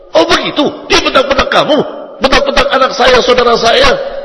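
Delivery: loud, high indignant outburst; outraged relatives. Retold or imitated. In-laws hearing the complaint, indignant and loud. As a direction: take offence on your child's behalf. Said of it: "Mereka jadi lebih marah".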